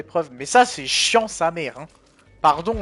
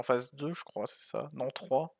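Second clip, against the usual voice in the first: whispered aside; 3 semitones lower, 12 dB quieter, slower than usual. Real, not acted. Muttering to self, counting.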